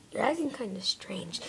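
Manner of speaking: whispered with a small laugh. Acted. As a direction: hush it, amused. Suspicious aside between the toys.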